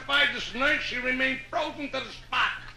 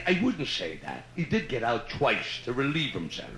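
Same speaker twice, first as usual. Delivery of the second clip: put-on Irish accent; 13 semitones lower, 5 dB quieter than usual. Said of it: "I gotta do an Irish story".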